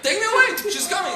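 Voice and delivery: put-on high, loud panicked child squeal; screaming little girl. Daughter begging her father as nurse approaches, mock screaming. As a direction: imitate a terrified child begging for rescue. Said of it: "She starts screaming".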